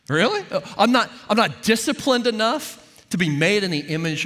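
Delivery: high-pitched mock disbelief; lively, sceptical talk-back; incredulous challenge. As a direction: repeat the accusation as if it were absurd. Mockingly questioning the inner voice.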